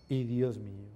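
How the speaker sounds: ordinary delivery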